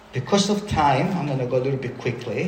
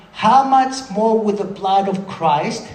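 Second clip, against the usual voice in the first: slow reading; 5 semitones higher, 4 dB louder, slower than usual. Shown as a demonstration. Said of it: "I'm gonna read this really slow".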